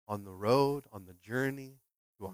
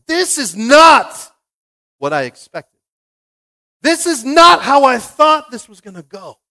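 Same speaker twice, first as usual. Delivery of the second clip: much higher, louder, slow protest; complaining to God; 9 semitones higher, 13 dB louder, slower than usual. Retold or imitated. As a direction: whine your protest up at God. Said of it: "I've had that conversation with God".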